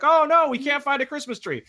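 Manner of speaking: high, loud mock panic; hammed-up alarm at no tree. X-Men acted in a panic.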